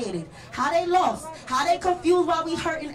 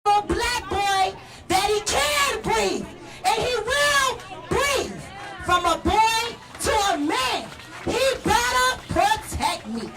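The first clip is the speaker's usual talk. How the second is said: impassioned; 5 semitones higher, slower than usual. Real, not acted. Climactic lines.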